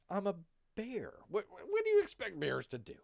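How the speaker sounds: high, loud indignant bear; mock protest. Bear answers the charge of killing the dog, mock protest. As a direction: protest it loudly, voice jumping up.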